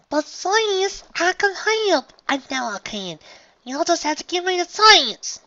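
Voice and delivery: put-on very high, excited child voice; eager little sister. A narrator's reading. Little sister begging to come along, eager. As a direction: plead eagerly, bouncing with energy. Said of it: "practically bounced in place".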